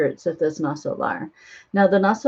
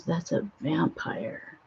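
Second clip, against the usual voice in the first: hushed awe; 10 dB quieter, slower than usual. Retold or imitated. Awed reveal of the vampire.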